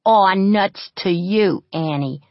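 mutter. A narrator's reading. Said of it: "muttered Pepper".

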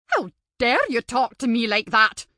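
a hint of a furious old teacher; outraged. Teacher outraged by a pupil's cheek, indignant.

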